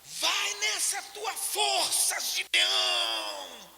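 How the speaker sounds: very slow, drawn-out shout from the pulpit; a pastor crying out in a booming, stretched shout; vehement preacher's shout. Retold or imitated. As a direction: preach it out across the street at full force. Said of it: "Ele gritava veementemente".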